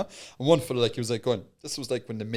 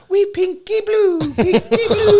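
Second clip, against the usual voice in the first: high, loud laughing sing-song cry; loud mock hawking; 10 dB louder, much slower than usual. Brother calling pinky blue at the bikies, loud high cry. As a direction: squeal it like a playful market trader. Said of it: "was like, going".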